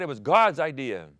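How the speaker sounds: ordinary talk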